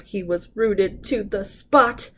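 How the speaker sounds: ordinary delivery, nothing marked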